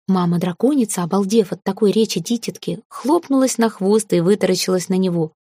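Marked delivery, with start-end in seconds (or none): none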